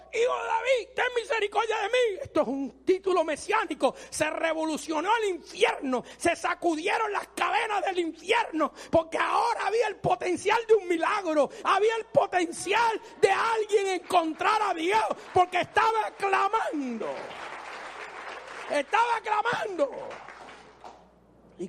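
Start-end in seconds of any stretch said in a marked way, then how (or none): 0.1-2.2 blind man's cry. Retold or imitated. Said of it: "empezó". 4.1-19.9 shouting preacher's cadence; fiery preaching. Acted. Preaching at full force.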